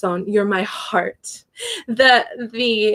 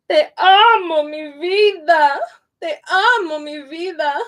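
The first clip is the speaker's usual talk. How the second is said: dramatic love declaration; 6 semitones higher than usual. Shown as a demonstration. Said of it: "I'm being a little bit dramatic".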